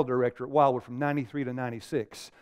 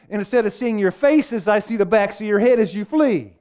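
higher, louder role-play as the outcast; preaching at full force; 7 semitones higher, 7 dB louder than usual. Acted. Preacher playing a leper outside the camp, loud and high.

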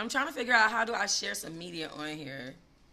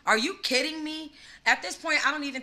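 incredulous; 7 semitones higher, 3 dB louder than usual. Real, not acted. Dragging Danny Lay.

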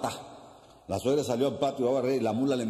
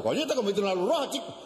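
high-pitched startled protest; alarmed passenger; 10 semitones higher than usual. Acted.

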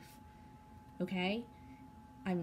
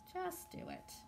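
a higher, softer sing-song nudge; high soft urging; 9 semitones higher, 7 dB quieter than usual. Real, not acted. Tarot reader coaxing the viewers in a higher voice. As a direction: lift it up lightly and coax.

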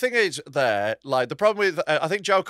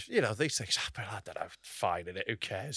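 much lower and softer, shrugging it off; dismissive mumble; 10 semitones lower, 14 dB quieter than usual. Acted. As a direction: mutter it like you couldn't care less. Trailing mock shrug.

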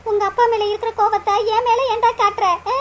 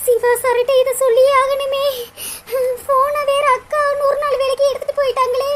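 tearful lament; 6 semitones higher than usual. Acted. Phone taken, cannot warn Siva.

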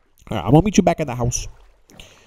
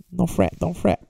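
ordinary talk

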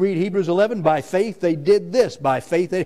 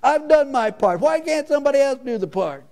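high, loud whining complaint; high-pitched mock sulk; whiny Peter; 11 semitones higher, 3 dB louder than usual. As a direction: whine like a put-upon child dodging chores. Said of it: "we whine about everything".

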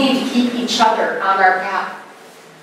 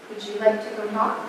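calm offer; 10 dB quieter than usual. Retold or imitated. Calm reply to an angry woman.

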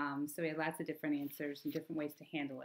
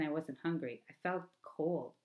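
usual speaking voice

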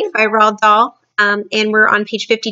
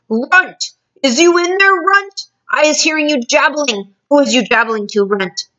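raised, higher giant's bellow; bullying shout, easing by the end; thunderous shout; 6 semitones higher, 3 dB louder than usual. A narrator's reading. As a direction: boom it out like thunder. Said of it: "a voice like thunder shouted".

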